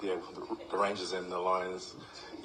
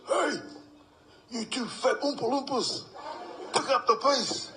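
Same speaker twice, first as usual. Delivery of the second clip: much higher, louder mock yell; coach yelling; 4 dB louder than usual. Coach yelling at trailing players, loud high mock yell. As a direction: yell at the slow ones at the back. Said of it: "He goes".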